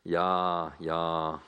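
low, lightly put-on German accent. German lady approving of his church, light German accent. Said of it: "the lady replied".

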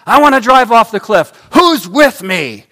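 loud, high, reckless shout to the passengers; a driver shouting back to the bus, loud and wild; shouted challenge. Acted. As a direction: yell it back over your shoulder, daring them. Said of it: "he shouts back".

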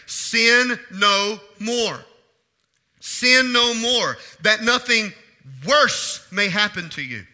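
loud, very slow, weighty words; emphatic scripture quoting. Real, not acted. Preacher quoting Jesus to the healed man, slow and emphatic. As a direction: declare each word as a solemn command.

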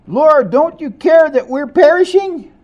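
high, loud panicked plea; frightened cry. Acted. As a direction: cry out to be saved from drowning. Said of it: "they cried out".

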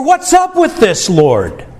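high exasperated plea, dropping at the end; venting cry. Retold or imitated. Believer venting pain to God, high exasperated plea. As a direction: throw the complaint up to God.

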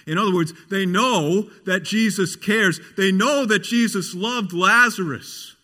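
high, forceful sermon delivery; impassioned preaching. Real, not acted. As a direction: press each rhetorical question on the congregation.

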